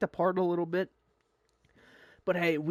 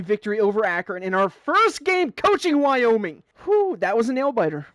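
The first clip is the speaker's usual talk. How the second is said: excited victory call; 6 semitones higher, 9 dB louder than usual. Real, not acted. Gamer celebrating a first win as coach, excited shout.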